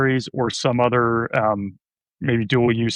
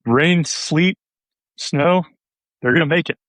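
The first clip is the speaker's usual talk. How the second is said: mock motto; 6 semitones higher, 3 dB louder than usual. Joking postal service motto.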